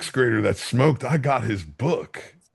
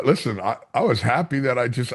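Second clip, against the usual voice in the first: usual speaking voice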